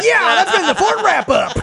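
high, slow, laughing hammed-up outro line; announcer outro. Cohost closing the show in a laughing announcer voice. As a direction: stretch it out like a cheesy announcer.

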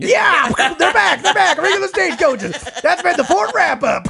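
high, loud, slow announcer shout; hammed-up shout. Cohost mocking the boring resolution with a shouted announcer outro. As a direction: bellow it like a cheesy trailer voice.

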